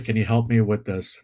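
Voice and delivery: usual speaking voice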